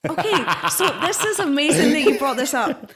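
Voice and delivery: Scottish accent, loud and laughing. Real, not acted. Host admits her Scottish accent to the interviewer, laughing. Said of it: "develop this amazing Scottish accent".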